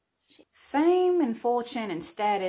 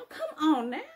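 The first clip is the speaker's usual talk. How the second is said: playful high repeat; 6 semitones higher than usual. Acted. Confessing to camera, playfully egging herself on, high and rising. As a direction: come on now jumps up high and rises at the end.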